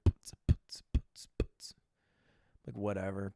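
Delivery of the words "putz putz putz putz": mouth-made techno beat, just breath on the putz sounds; imitated DJ beat. Mocking the French DJ's set, mouth-made beat. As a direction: mimic a thumping DJ beat with your lips. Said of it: "He's like".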